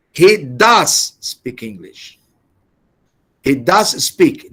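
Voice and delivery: does hit hard, slow and deliberate; emphatic does. Shown as a demonstration. Teacher drilling emphatic do, does slow and stressed. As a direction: press down on does. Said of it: "emphatic action is done with the auxiliary verb do".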